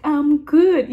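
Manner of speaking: imitated American accent, high and loud; high, punchy accent imitation; put-on local accent. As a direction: answer in a mock American accent. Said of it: "You know that accent".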